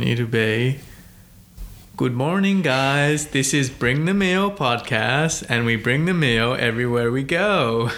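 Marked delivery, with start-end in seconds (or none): 2.0-7.9 mock American podcast host greeting, pitched up; American accent. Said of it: "if I was to give an impersonation".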